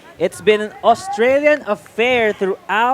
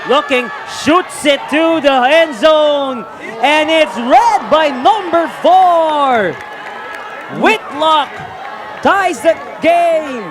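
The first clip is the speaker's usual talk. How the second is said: excited; 4 semitones higher than usual. Real, not acted. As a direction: call the score with rising excitement. Excited play-by-play of a score.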